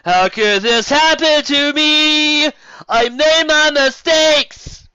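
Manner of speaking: high, loud, slow melodramatic lament; hammed-up despairing wail. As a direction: wail it like a soap opera hero. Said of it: "A solitary tear found its way down his cheek".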